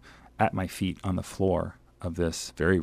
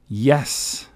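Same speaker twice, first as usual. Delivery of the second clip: higher, restrained read-aloud cheer; yelled out; 7 semitones higher, 5 dB louder than usual. A narrator's reading. Traveler cheering the freed bus, mild yell. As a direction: call out a mild triumphant yell. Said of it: "I yelled out".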